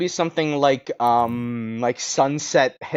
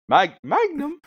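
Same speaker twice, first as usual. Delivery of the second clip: very high, startled Magnum; much higher surprised call; Jessica Fletcher; 11 semitones higher, slower than usual. Shown as a demonstration. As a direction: squeal it up as the old sleuth. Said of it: "Hold on".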